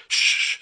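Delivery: unvoiced shush. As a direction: hush the room with a shush. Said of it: "we're like".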